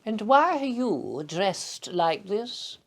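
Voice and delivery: high, slow, regal imitation; a posh queenly question, drawn out. The Queen asking a man dressed as an overgrown schoolboy, regal imitation. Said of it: "she shook my hand and said".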